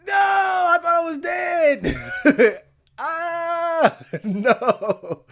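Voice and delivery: high, slow panicked wail; panicking gamer. Real, not acted. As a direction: wail it in fright. Player being killed.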